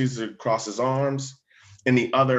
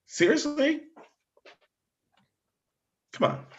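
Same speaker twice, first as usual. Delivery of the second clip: real disbelief; 3 dB quieter than usual. Real, not acted. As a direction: let the disbelief jump way up in pitch. Speaker outraged at the profiling.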